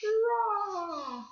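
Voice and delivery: "Draw" high with long falling aw; vowel switch demo. Shown as a demonstration. Teacher has class repeat the word, high stretched "Draw". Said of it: "Say it".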